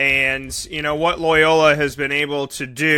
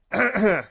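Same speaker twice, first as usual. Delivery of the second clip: short choking cough; 7 semitones higher than usual. Real, not acted. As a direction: clear the choke with a cough. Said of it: "Oh, I'm sorry".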